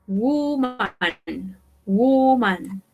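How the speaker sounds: "woman" with a long, drawn-out U; "woman" said slowly as WOO-man; U sound in woman. Shown as a demonstration. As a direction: stretch the first vowel of "woman" as oo. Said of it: "la pronunciación es con U".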